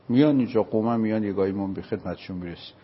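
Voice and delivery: normal talking voice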